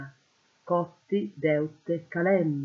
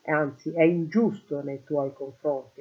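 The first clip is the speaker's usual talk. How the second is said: usual speaking voice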